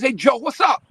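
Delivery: lightly put-on New York hail; New York greeting. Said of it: "You know, the New York stuff".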